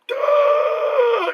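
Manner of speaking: high, loud mock dying cry; strangled death cry. Vlogger acting the stabbed cameraman, high strangled cry. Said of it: "He's like".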